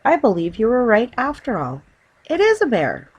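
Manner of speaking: rising high and loud in amazement; excited realisation; amazed. Acted. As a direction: realise it with astonishment. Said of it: "she exclaimed".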